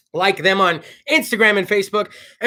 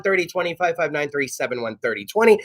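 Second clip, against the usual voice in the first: ordinary delivery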